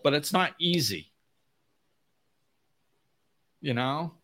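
usual speaking voice

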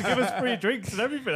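laughing telling. Real, not acted. Laughing retelling pub story.

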